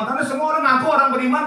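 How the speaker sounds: usual speaking voice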